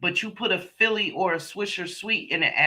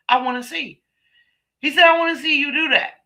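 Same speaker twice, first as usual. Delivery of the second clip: higher, fast excited imitation; eager boyfriend; 9 semitones higher, 6 dB louder, faster than usual. Boyfriend eager to see her smoke it, high quick mock eagerness. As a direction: play him itching to watch.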